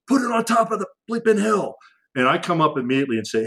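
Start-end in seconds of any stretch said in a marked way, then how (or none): 0.1-1.7 desperate order to drop the bomb; urgent demand. Retold or imitated. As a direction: yell it like a soldier under fire. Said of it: "he goes".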